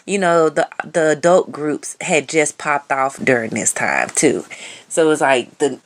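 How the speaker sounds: usual speaking voice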